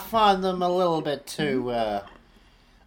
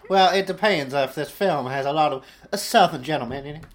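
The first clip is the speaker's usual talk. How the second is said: put-on southern accent; 4 dB louder than usual. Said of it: "Can you do the whole podcast in this accent?".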